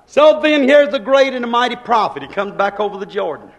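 loud proclamation to the congregation; ringing sermon declaration; heralding preacher. Real, not acted. Preacher heralds the prophet's return.

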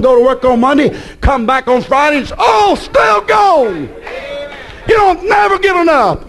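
preacher climbing to a cry; building preacher's cry. Real, not acted. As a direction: build the list to a shouted peak. Sermon building to climax.

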